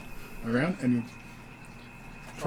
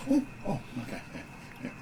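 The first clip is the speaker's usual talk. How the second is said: startled; 5 dB quieter, much faster than usual. Acted. Opens eyes, startled by goat.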